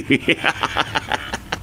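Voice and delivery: soft, breathy evil snicker. Acted. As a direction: snicker quietly at the news. Gloating over Mister Choi's death. (nakaal-alas a katawa)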